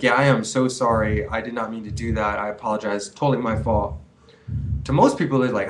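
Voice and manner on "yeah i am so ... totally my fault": low, subdued owning-up; sincere apology. Acted. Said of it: "if I made a mistake, I'd be like".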